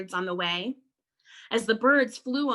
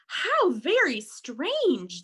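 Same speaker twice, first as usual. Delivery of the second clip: zdziwione mamrotanie; 6 semitones higher, 4 dB louder, slower than usual. Acted. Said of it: "they all murmured".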